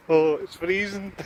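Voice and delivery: put-on Newcastle accent. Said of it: "parody of Mister Neil Morris".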